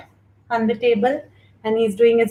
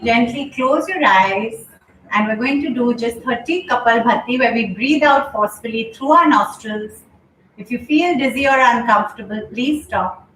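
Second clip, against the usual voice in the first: calm, unhurried class guidance; yoga instruction. Acted. Yoga teacher guiding her online class, calm instruction. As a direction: guide the class gently through the breath. Leads a breathing session.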